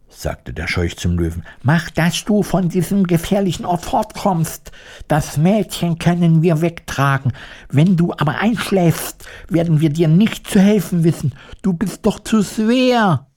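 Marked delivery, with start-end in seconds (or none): 1.6-13.3 high-pitched, anxious-to-help Scarecrow voice; urgent Scarecrow. Acted. Scarecrow sending the drowsy lion away, urgently. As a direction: urge him away in a high character voice.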